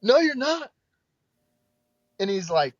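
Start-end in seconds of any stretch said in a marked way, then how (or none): 0.0-0.7 high, loud mock objection; imitated emphatic protest; retold alarmed refusal. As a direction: shoot it down, pitched way up.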